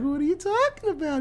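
very high, feigned-innocent voice; mock innocence. Leia denying it to Vader, imitated high and innocent. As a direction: play dumb in a squeaky-high imitation.

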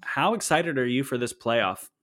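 ordinary talk

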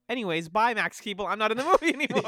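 high, loud comic sign-off; breezy mock goodbye. Imitating the wildlife guy bowing out of the movie, breezy. Mimics character abruptly leaving film.

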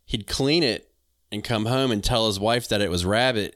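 normal talking voice

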